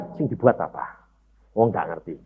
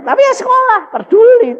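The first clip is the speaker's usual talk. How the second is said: high, loud defiance; defiant exclaim; 8 dB louder than usual. Real, not acted. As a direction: fling it out, not caring. Defiant going to school anyway.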